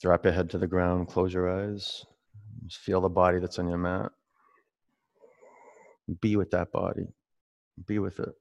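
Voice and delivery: soft, low, settling yoga voice; guided meditation. Real, not acted. Yoga teacher guides the class into resting on the mat, calm. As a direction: guide them gently into stillness, softly.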